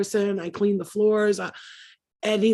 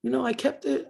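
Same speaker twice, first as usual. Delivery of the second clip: ordinary talk, nothing marked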